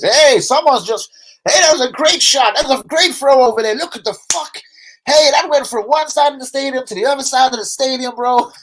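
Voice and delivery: high, loud mock American sports fan; hammed-up American commentator yelling. Streamer mocking a Super Bowl watch along in a loud American voice. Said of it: "just doing an American voice".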